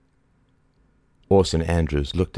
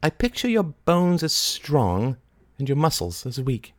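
a hint of odd Mary; 10 semitones higher than usual. A narrator's reading. Mary voiced in story.

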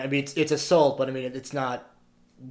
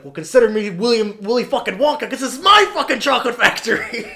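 loud, high retold movie threat; hammed-up menace, pitched high and pushed loud; mock threatening; 8 semitones higher, 6 dB louder than usual. Quoting Otis threatening two men, mock threatening. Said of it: "threatening these two men".

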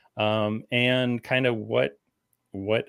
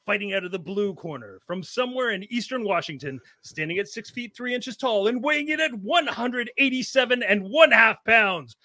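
ringmaster; 8 semitones higher than usual. Pretend. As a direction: roll out the fighter's name like a boxing announcer. Boxing ring introduction of fighter.

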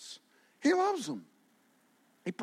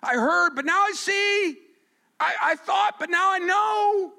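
fervent confession; 10 semitones higher, 5 dB louder than usual. Retold or imitated. Job voiced confessing to God.

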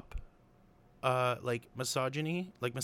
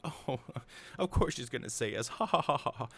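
cocky mock laugh, pitched up; smug Gaston; 5 semitones higher than usual. Gaston boasting to the villagers, smug laughing. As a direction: laugh it off like the answer is obvious. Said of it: "it's wild that he has that big of an ego".

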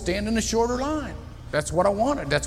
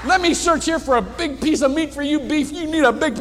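much higher, eager offer; eager generosity; 7 semitones higher than usual. Retold or imitated. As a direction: make it a treat. Steve offering big meat.

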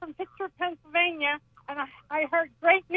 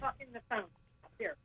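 phone held away; 20 dB quieter, faster than usual. Real, not acted. Caller turned away from phone.

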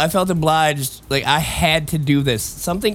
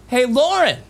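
calling his sister; 7 semitones higher than usual. Retold or imitated.

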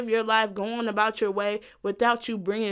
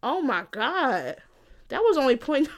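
ordinary talk

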